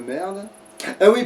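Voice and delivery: usual speaking voice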